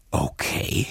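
low whispered okay; resigned whisper. Acted. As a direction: barely breathe it. Boy gives in quietly.

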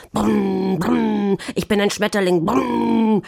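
put-on boisterous kid trumpeting. A narrator's reading. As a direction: blare it out like a toy car. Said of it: "posaunte er".

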